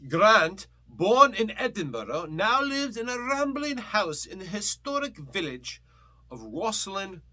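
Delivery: high, loud mock-Scottish reading; put-on Scottish accent. Host reading record sleeve aloud, put-on Scottish accent. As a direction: read it as a hammed-up Scot, pitched well up. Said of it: "should I just read this in a Scottish accent?".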